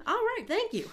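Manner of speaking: very high, loud pretend presenter thanks; hammed-up broadcast thanks; mock co-anchor reply. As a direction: take the hand-off in an over-the-top high voice. Answers the pretend broadcast hand-off.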